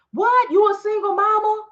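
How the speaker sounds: high, loud mock gasp of disbelief; playing the shocked helper who gives it all away. Mocking herself, hamming up shock at a follower's news.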